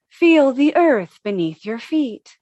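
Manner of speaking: ordinary delivery, nothing marked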